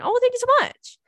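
high, loud delighted squeak; squealing thank-you to a sweet fan; flattered squeal. Real, not acted. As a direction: gush with sudden delight. Flattered by listener's compliment.

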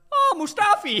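high, loud put-on German accent greeting; mock German accent. Greeting Mustafi at Heathrow, hammed-up German accent. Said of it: "in a German accent".

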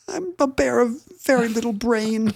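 high, soft little bear voice; small, high-pitched cartoon bear; Winnie the Pooh. Pooh answering Christopher Robin, high soft bear voice. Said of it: "And Pooh would be like".

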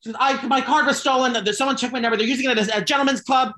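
high, prim mock-polite voice on the phone with the bank; prim, high complaint to the bank; prissy phone voice. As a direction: put on a high, overly proper customer voice. Retold call to the bank.

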